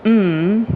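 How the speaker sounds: "mm" hummed with a rise and a fall; change the meaning with the hum alone; a meaningful Japanese hum; tonal "mm". Shown as a demonstration. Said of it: "just in the tone".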